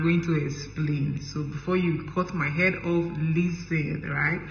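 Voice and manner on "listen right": usual speaking voice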